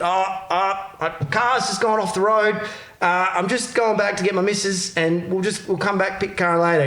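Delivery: put-on high, sheepish drunk voice; mock drunk excuse to the cop; imitating the drunk guy. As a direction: pitch up and play the sheepish drunk.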